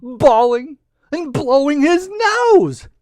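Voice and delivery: high, loud wailing narration; hammed-up weepy storytelling; mock bawling. A narrator's reading. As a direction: stretch the words out like exaggerated sobs. Narrator mimics the lizard's bawling.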